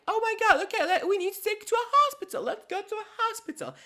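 very high, fast squeaky panic; panicked squeaky character. Retold or imitated. Friends panicking about getting to hospital, high squeak. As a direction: rush her to the hospital in a puppet voice. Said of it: "they're like".

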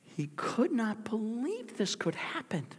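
high whispered anguish. A narrator's reading. Preacher describing Peter's grief, high whispered disbelief. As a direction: breathe it out in disbelief.

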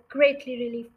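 ordinary delivery, nothing marked

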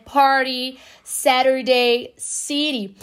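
"party, Saturday, city" with each T tapped like R; flapped T as R. Shown as a demonstration. Teacher illustrating the R sound, American flapped T. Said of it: "quando eu digo som do R".

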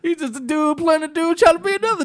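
high, loud quote through laughter; very high laughing movie quote; Tropic Thunder quote. Retold or imitated. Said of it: "if Robert Downey Junior can get away with it".

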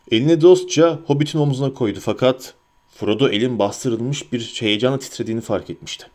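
ordinary delivery, nothing marked

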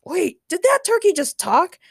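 high, loud disbelieving question; incredulous. A narrator's reading. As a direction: ask in total disbelief. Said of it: "Ray asked incredulously".

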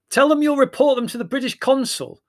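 loud, high call over the noise. A narrator's reading. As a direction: shout it above the uproar. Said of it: "raising his voice above the noise".

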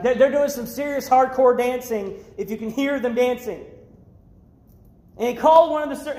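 normal talking voice

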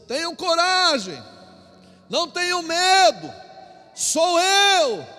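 high, loud call across the water; calling from afar. Acted. Jesus calling to the frightened disciples, a high reassuring shout. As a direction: shout it over the storm to reassure. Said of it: "Jesus gritou a certa distância".

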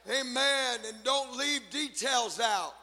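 ordinary talk

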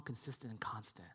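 soft unvoiced whisper; whispered tender promise. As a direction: breathe the words. God's voice.

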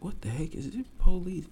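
jolted awake and baffled; startled waking. Retold or imitated. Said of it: "I'm like".

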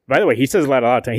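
ordinary talk, nothing marked

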